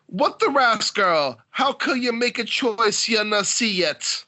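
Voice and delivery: slow, careful patois read from the book; light Jamaican accent, slow. Shown as a demonstration. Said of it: "like it says here".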